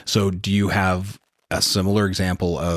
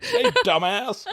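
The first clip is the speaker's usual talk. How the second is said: laughing mock scolding. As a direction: laugh out a playful insult. Voicing his spirit guides.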